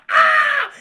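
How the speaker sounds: high piercing yelp of pain; pained shriek. Retold or imitated. Bitten on the ankle, high pained shriek. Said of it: "I go".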